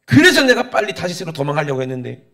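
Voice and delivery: loud, indignant mock protest; resentful complaint. Jonah justifying his flight to God, loudly resentful. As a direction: burst out loudly in reproach. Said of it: "원망합니다".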